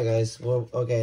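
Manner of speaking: normal talking voice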